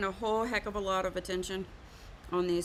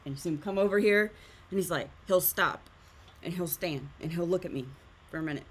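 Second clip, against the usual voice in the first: normal talking voice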